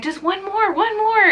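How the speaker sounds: imitating a nurse, high and eager; nurse encouraging. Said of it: "And they're like".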